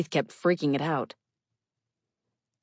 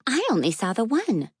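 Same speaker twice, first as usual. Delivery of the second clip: put-on child voice; 6 semitones higher than usual. Moth familiar child speaking.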